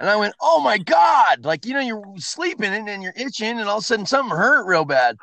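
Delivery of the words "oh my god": slow, higher, louder gasped oh my God; horrified at the discovery; drawn-out alarmed cry; shocked. Retold or imitated. Waking to find the sore.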